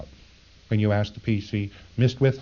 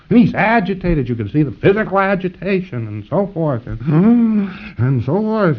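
higher and louder, worked up; agitated; 8 semitones higher, 4 dB louder than usual. Acted. Lecturer to students, acting out an agitated PC, higher and louder. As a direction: get worked up describing him.

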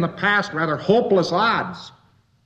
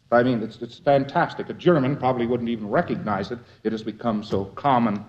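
ordinary talk

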